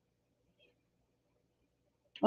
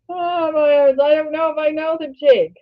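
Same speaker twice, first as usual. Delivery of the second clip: tearful, half-laughing voice, pitched higher; teary laugh-cry; 7 semitones higher than usual. Real, not acted. Vlogger to her viewers, laughing through tears. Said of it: "I don't know why I'm teary today".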